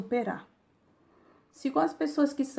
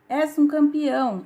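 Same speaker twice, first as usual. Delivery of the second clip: raised voice, higher and louder; narrated shout; 6 semitones higher, 7 dB louder than usual. A narrator's reading. Father calls to the mountain, moderate shout. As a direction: call it out loud and high. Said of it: "De novo o homem grita".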